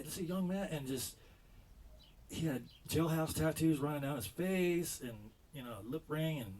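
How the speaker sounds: ordinary talk, nothing marked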